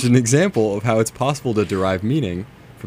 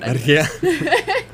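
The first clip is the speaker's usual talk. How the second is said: high, laughing Irish "Marianne" with rolled R; Irish accent; 4 dB louder than usual. Shown as a demonstration. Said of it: "I'm gonna say it like that".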